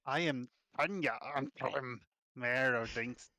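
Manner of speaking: tentative attempt at an orcish accent; failed orcish twang. Game master retrying the mayor's introduction, faltering orcish accent. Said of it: "I just can't get this orcish accent down".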